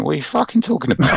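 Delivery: retold actor's incredulous comeback, lightly voiced; John Pertwee. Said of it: "I hope he said it in that voice".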